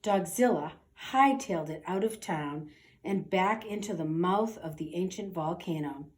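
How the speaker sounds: ordinary delivery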